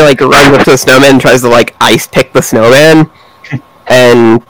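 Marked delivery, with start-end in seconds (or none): none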